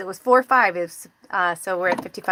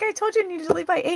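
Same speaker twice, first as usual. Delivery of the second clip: exasperated; 9 semitones higher, faster than usual. Retold or imitated. Scolding husband, retold.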